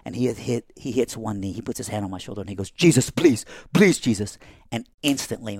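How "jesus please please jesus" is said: loud, high cry of Jesus's name; retold yell. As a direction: call out to Jesus in a raised, urgent voice. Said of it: "my dad yells out the name of Christ".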